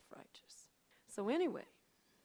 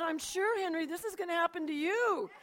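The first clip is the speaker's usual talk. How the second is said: loud, high, excited proclamation; acted excited warning, nearly shouting; emphatic insistence; 9 semitones higher, 10 dB louder than usual. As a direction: proclaim it at him with full force. Acts out her triumphant warning to husband.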